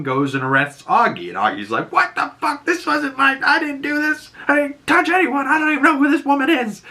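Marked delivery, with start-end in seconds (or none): 1.9-6.8 very high-pitched mock outraged squeal; panicked protest. Augie to the arresting detective, indignant high-pitched mock protest. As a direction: protest innocence in a squeaky, much higher voice.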